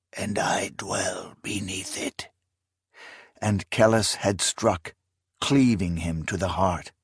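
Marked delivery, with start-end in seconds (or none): 0.1-2.3 unvoiced uncanny whisper; voice not his own. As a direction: whisper like a possessed old man. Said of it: "his voice not his own".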